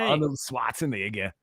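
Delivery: put-on Austrian accent on the name; mock Austrian-accent impression; Arnold Schwarzenegger. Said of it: "Say Arnold Schwarzenegger in an Arnold Schwarzenegger voice".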